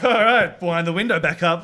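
high, loud flustered rush; startled retreat. Retold or imitated. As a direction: back off fast in alarm. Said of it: "we're just like".

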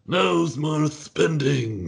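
slow, high, drawn-out Reagan voice; Reagan impression. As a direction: drag it out like an old president. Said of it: "That's my Reagan impression".

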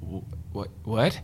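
stammered, disbelieving question, starting soft; imitating a stunned reaction; baffled double take. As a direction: act out being thrown by surprise. Editor stunned by hearing the accent.

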